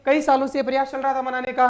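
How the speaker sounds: usual speaking voice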